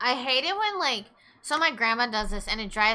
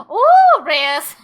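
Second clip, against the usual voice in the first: high, loud delighted gasp; light up on seeing the name; excited greeting; 6 semitones higher, 15 dB louder than usual. Real, not acted. Streamer excitedly greeting a viewer, high and loud.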